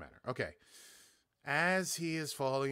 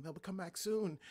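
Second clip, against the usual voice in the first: higher, lightly voiced distressed young woman; the Queen luring the French officer close, in a higher mock distressed voice; 9 semitones higher, slower than usual. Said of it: "using a young woman's voice in distress".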